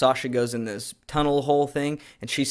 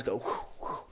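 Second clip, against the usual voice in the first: breathy mock whooshes of a blade; whoosh sound effect; 10 dB quieter than usual. Vlogger mimicking knife training, soft whooshing. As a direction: whisper the knife swipes.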